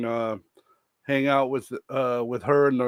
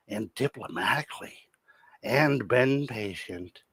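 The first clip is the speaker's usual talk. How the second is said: mock whisper; 2 semitones lower, 6 dB quieter than usual. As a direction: drop to a breathy mock whisper. Mock reading of streamer's description.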